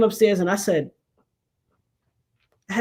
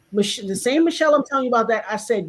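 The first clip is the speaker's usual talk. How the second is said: ordinary talk, nothing marked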